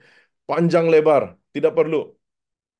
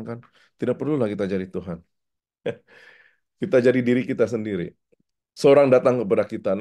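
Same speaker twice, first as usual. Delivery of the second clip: ordinary talk, nothing marked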